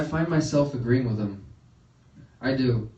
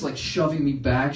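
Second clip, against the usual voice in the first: ordinary delivery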